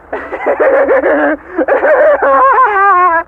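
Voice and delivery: high mocking cackle; evil cackle. Machine god mocking the humans, cackling. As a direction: laugh down at them. AI gloating over captives.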